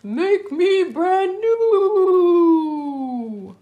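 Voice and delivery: high, loud and very slow, stretched out; slow eerie puddle voice. Acted. The puddle voice calls to the children, slow and eerie. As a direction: drawl it out like a ghostly voice from a puddle. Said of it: "A voice from the puddle said".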